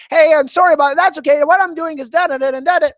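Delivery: pushy pitching over noise. Shown as a demonstration. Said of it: "You don't wanna be that person".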